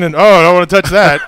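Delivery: hammed-up squeamishness; squeamish recoil. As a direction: recoil loudly from something gross. Mocks squeamish fighters near blood.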